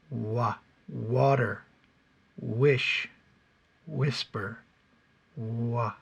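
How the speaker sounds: water, wish, whisper with pursed lips; slow W words with lips pushed forward; W sound demo. Shown as a demonstration. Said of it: "I purse my lips".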